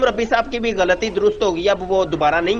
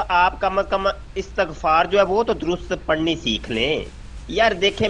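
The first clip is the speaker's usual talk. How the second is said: ordinary talk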